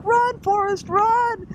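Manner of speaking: a high cheering shout; egging someone on to run; mock cheering. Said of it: "He was like".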